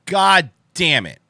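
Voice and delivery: slow, loud, frustrated; frustrated curse. Real, not acted. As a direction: drag out the curse, fed up. Outed on stream, frustrated.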